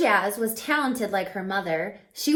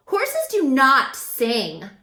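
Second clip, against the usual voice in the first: slow, louder scoffing horse; scornful horse; 4 semitones higher, 8 dB louder, slower than usual. Acted. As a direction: look down your nose at her. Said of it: "said another".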